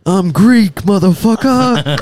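loud, high, drawn-out battle cry; hammed-up shouted impression, last word stretched; Kratos. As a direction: shout it as a mock warrior boast. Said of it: "I always think of Kratos".

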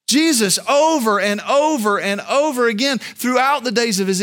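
loud, high-pitched preaching at full force; ringing, driving sermon delivery; impassioned preaching. Real, not acted.

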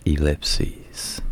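unvoiced whisper; whispered aside. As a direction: whisper the punchline. Whispered punchline.

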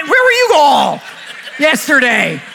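high, loud mock-indignant shout; joking call-out. Real, not acted. Preacher teasing the congregation for being absent, joking shout. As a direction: holler it in playful complaint.

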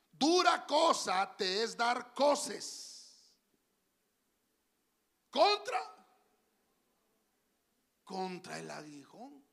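slow, loud, stretched Bible reading; dramatic scripture reading. Acted. Preacher reading Acts to the congregation, slow and solemn. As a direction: read the verse slowly with full weight.

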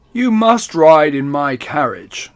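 slow, loud regal invitation; kingly voice. Acted. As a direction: speak as a generous king. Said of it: "said the king".

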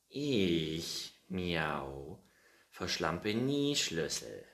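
huffy, drawn-out denial; very offended cat. Acted. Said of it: "antwortete der Kater sehr beleidigt".

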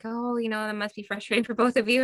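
lightly acted high, sympathetic parent voice; mildly overdone sympathy for a child; placating parent. Parent placating the other child, retold.

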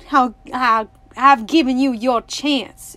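loud, agitated declaration; shrilling. A narrator's reading. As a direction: burst out loudly, a touch shrill. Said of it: "shrilled the man in the yellow suit".

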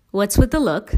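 usual speaking voice

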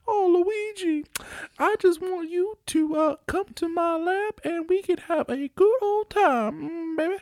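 put-on high, sassy old grandma voice; E-Gag voice. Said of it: "a sassy old, basically my grandma".

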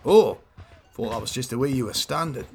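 ordinary talk, nothing marked

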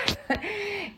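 high little laugh; brief amused laugh; short laugh. Real, not acted. Laughs at own point.

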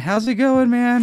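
high, loud hello; excited greeting. Real, not acted. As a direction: greet him with big energy. Greeting a new guest.

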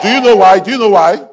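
high, loud demanding question; rousing preacher. Real, not acted. Preacher challenges the congregation with a high, loud question.

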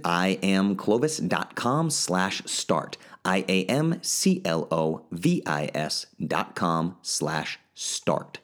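slow, careful ad read; slow and deliberate. Acted. Host reading the web address to listeners, slow and clear. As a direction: spell the address out slowly and clearly.

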